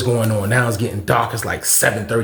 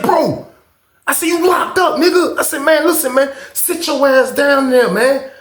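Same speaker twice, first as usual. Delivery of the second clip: taunting back; 12 semitones higher than usual. Retold or imitated. Taunting the locked-up neighbor, heated. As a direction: rub it in his face.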